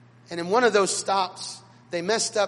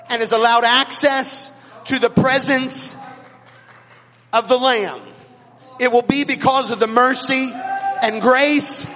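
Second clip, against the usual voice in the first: rising, louder preacher's cry, slowed on the grace line; full-force preaching; 4 semitones higher, 7 dB louder than usual. Acted. Preacher urging the congregation to clap for grace, full-force preaching. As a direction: preach it to the rafters, climbing toward the applause. Preacher builds to altar call.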